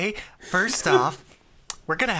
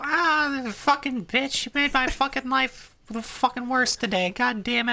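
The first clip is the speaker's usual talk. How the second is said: put-on much higher, exasperated kid rant; 10 semitones higher than usual. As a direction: vent like a furious little kid. Kid ranting to dad.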